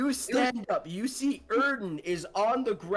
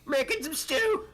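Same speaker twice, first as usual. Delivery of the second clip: high character voice; 8 semitones higher than usual. Acted. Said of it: "echoing the character line".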